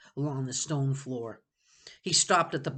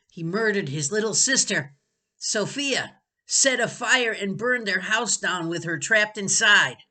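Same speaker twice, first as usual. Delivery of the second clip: louder, higher cruel accusation; loud taunting; 6 semitones higher, 8 dB louder than usual. Acted. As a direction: taunt him loudly. Benny taunting loudly.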